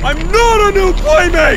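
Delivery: loud, high mock protest; alarmed protest. Victim denying being monster's playmate, loud high protest.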